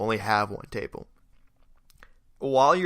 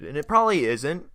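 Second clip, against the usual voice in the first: ordinary talk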